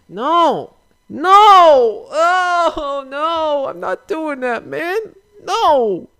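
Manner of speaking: very high, loud whiny refusals; high-pitched tantrum of refusal; childish whine. Pretend. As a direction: whine no like a cornered kid. [name] whining refusal in skit.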